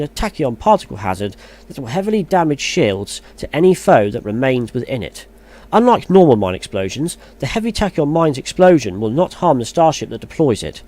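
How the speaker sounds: steady, formal read-aloud of patch notes. Acted. Vlogger reading patch notes to his viewers, formal read. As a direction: read the blog out like an announcement.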